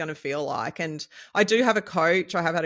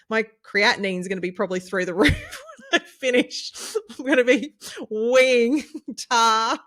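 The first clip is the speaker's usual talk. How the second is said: laughing; 7 semitones higher, 5 dB louder than usual. Real, not acted. Joking about creatinine levels.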